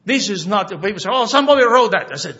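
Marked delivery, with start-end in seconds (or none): 1.1-2.1 quick, loud, higher mock of a doubter; mocking skeptic. Pastor mimicking people who doubt the Bible, loud scoffing. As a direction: toss it off like a scoffer.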